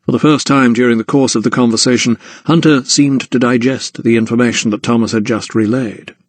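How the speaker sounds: ordinary delivery, nothing marked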